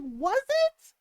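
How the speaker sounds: high, rising mock doubt; sceptical question. Parent to child with cheese bellyache, sceptical. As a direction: retell a parent's pointed disbelief.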